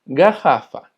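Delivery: garrafa said "ga-HA-fa", the rr as a throaty h; Portuguese double R. Shown as a demonstration. Teacher modelling double R for students, throaty h. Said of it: "se va a escuchar como una J".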